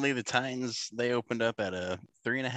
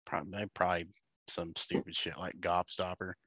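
ordinary delivery

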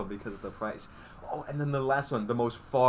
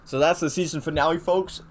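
louder announcer sign-off; podcast host outro; 7 dB louder than usual. Acted. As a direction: wrap up the show for listeners.

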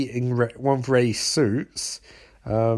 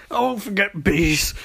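higher, strained cry; mock distress; 6 semitones higher than usual. Barry just revived after bees, high mock distress. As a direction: say it high and shaken up. Barry recovering, first take.

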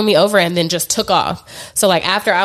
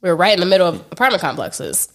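normal talking voice

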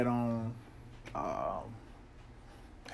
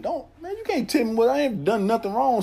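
high, raised protest; heated protest; 10 semitones higher, 3 dB louder than usual. Retold or imitated. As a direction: push back, feeling wronged. Protesting to officer.

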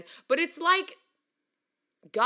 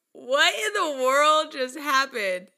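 baffled disbelief; 4 semitones higher, 4 dB louder, slower than usual. Retold or imitated. Shocked reaction retold.

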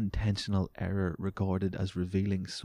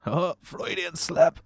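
much higher and slow, a hammed-up smug exclamation; smug quip voice; 10 semitones higher, 5 dB louder, slower than usual. Podcaster imitates a show-off at a party, high and hammed-up. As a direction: crow it like you just scored a point. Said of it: "good comedy in a group situation".

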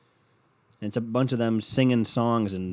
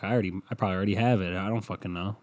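ordinary delivery

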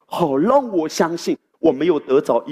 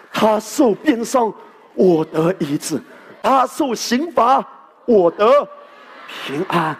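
slow, weighty proclamation, each phrase punched; emphatic preaching; 4 semitones higher, slower than usual. Acted. The pastor proclaims the scripture to the church, slow and emphatic. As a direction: drive each phrase home slowly.

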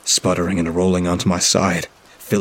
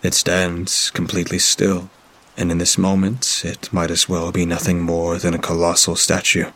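usual speaking voice

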